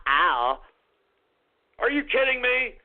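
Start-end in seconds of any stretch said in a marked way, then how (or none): 0.1-0.6 mock giggle ending in a low "ow"; titter, then a deep, teasing ow. Host mimicking men he mocks, put-on giggle. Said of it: "these idiots would shake their ass and go".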